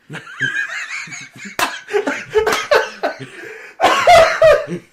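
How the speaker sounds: long, loud, high-pitched fit of laughter; uproarious laugh. Real, not acted. Reacting to the comic's punchline, uproarious laugh. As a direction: crack up completely at a punchline.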